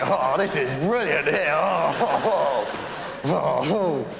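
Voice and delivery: high, loud, slow swooning delight; blissful delight. Pretend. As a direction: melt with joy at a roadside cafe. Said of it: "It's like".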